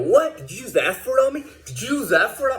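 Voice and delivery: high, indignant mock guard voice; imitating a guard offended by a swear word; mock outraged guard.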